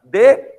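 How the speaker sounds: "defeito" broken up, DE punched out loud; loud, separated syllables in "defeito" up to the stressed one; counting syllables. Shown as a demonstration. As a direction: say "defeito" as DE... FEI, separate beats. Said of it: "ele vai ter só duas".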